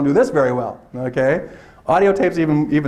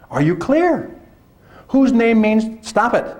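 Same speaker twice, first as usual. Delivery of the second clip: usual speaking voice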